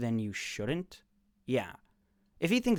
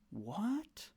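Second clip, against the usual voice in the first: a high, soft, stunned question; 7 semitones higher, 9 dB quieter than usual. Real, not acted. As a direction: react in flat disbelief. Stunned by the conclusion.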